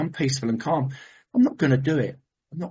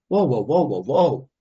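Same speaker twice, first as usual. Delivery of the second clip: swooping run of whoas; halting himself; 3 semitones lower, 4 dB louder than usual. Retold or imitated. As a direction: rein yourself in with drawn, wavy whoas. Halting his own racing thoughts.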